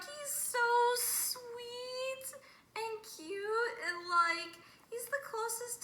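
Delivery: high-pitched, drawn-out cooing; adoring gush. Real, not acted. Fan gushing over favorite character, adoring. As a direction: coo over someone adorable, stretching the words high.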